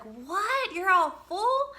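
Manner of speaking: high, loud, stretched-out "What? You're all full?"; shocked disbelief. Retold or imitated. Camper told the campsite is full, replies in loud high shock.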